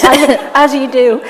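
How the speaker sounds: laughing through the words; amused laughter at a grim joke; real laughter. Real, not acted.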